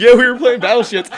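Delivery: loud, high, laughing admission; high-pitched burst of amused storytelling; real laughing outburst. Real, not acted. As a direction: crack up as you blurt it out. Cracks up starting bathroom story.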